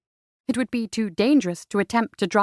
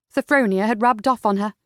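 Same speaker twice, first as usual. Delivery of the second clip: ordinary delivery, nothing marked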